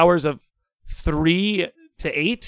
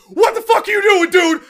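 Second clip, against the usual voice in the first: high furious yell at the roommate; screaming; 6 dB louder than usual. Retold or imitated. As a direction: scream it, startled and livid. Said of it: "I'm, like, screaming at him".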